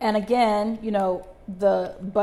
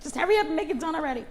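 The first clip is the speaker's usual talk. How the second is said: high, fast, mock-impatient whine; 8 semitones higher, much faster than usual. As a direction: hurry the sandwich along in mock frustration. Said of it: "I'm just like".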